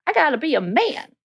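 high-pitched mocking imitation of macho men; mock macho man. Voicing men who avoid caring work, high mock-macho protest. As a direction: mimic a man insisting on his manhood. Mimicking men's toxic masculinity.

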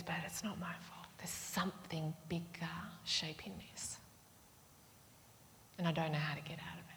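very soft, near-whispered admission; hushed confession. Acted. Preacher voicing a trapped soul's emotions, hushed. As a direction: say it small and helpless, almost under the breath.

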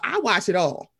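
ordinary delivery

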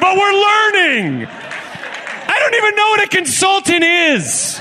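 high, slow squeaky voice; high mock earnest. Host admitting ignorance to the crowd, high and slow.

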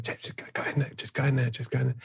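whispering James onto the stage; hushed urging. Retold or imitated. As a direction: rush him in quietly.

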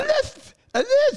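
very high, lisped "lisp"; squeaky mock panic, lisping on "lisp"; exaggerated lisp. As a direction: say "lisp" with a thick, slurred S. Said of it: "Do I have a lisp?".

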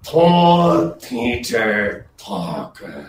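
ventriloquist lizard voice, fading. Shown as a demonstration. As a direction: say it as a lizard dummy with lips shut. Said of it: "do the lizard's voice at the same time".

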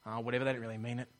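muttered cynical brush-off, fast and low. Acted. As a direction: toss it off like a cynic. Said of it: "We need to not be cynical".

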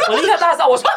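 normal talking voice

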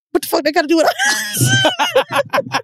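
high-pitched, slow, breaking into laughter; laughing retold protest. Man dismisses the crying women, retold through laughter. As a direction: crack up while imitating his protest.

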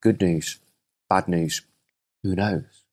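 a hint of a low, unbothered old man; lightly voiced wise old rancher; calm old farmer. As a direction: shrug it off, slow and even. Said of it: "And the old boy goes".

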